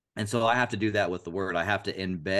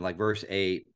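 ordinary talk

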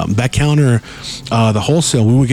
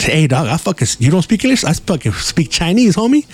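excited; 6 semitones higher than usual. Retold or imitated. Retelling his comeback to friend.

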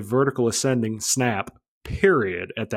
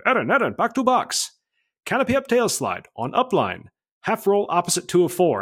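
high, swooping imitation of the coach; coach on the radio; 8 semitones higher than usual. Coach radioing the next maneuver to his pilot, high-pitched mimicry. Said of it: "He comes back on the radio".

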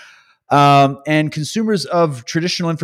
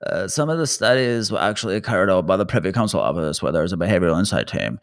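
a lightly mocking, lower, mid-throat imitation of Tam; mocking Tam's delivery; 6 semitones lower than usual. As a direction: mumble it back the way she would say it. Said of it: "the way she would say it would be like".

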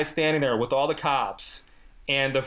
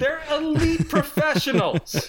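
sarcastic; 7 semitones higher, 4 dB louder than usual. Acted.

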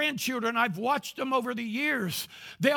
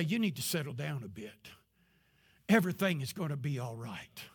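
soft, low comforting words; calm someone down gently; hushed, soothing murmur; soothing reassurance; 8 semitones lower, 5 dB quieter than usual. Retold or imitated. Preacher voicing a comforting touch.

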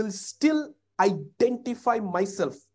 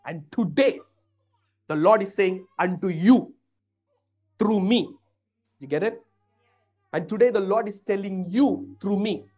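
louder, declaring it to the room; loud preaching proclamation; 2 semitones higher, 7 dB louder than usual. Acted. Preacher telling the congregation God speaks through him, loud. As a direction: proclaim it like a prophet.

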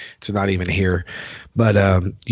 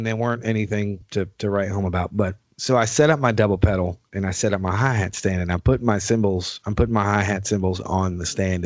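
normal talking voice

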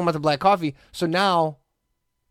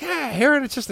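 much higher chirpy call; mock cheery greeting; 13 semitones higher than usual. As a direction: imitate her bright nickname shout-out. Mimicking barista's nickname greeting.

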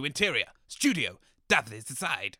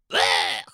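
dying gasp; 13 semitones lower, 3 dB louder than usual. Acted.

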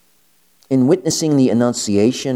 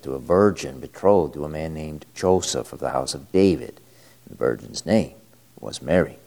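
lower, solemn liturgical reading; Gospel reading; 3 semitones lower than usual. Acted. Priest reads the Gospel aloud.

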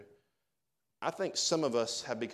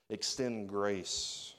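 slow emphasis; 3 semitones lower, much slower than usual. Shown as a demonstration.

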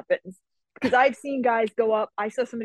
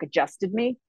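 ordinary delivery

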